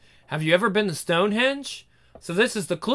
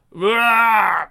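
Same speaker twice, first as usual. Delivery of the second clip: pained cry; 5 dB louder than usual. Acted. Injured cry.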